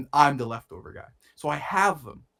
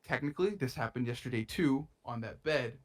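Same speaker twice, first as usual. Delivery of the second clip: ordinary talk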